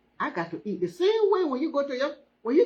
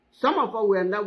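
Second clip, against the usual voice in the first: ordinary delivery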